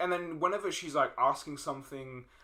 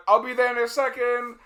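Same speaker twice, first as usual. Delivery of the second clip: innocent stalling; 9 semitones higher, 10 dB louder than usual. Retold or imitated. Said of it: "and it's like".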